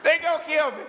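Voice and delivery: high hammed-up terror; comic frightened wail; mock panic. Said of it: "He was scared to death".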